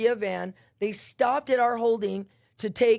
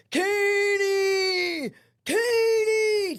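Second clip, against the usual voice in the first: very high, long-held holler; imitating a far-carrying call, stretched out; yelling a name; 10 semitones higher, 4 dB louder, much slower than usual. Woman escorted past the cells hunting for someone, yelling a name. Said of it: "yelling my name".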